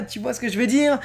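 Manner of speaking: ordinary talk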